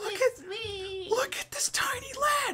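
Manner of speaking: high, breathy coo at something tiny; cooing squeal. Real, not acted.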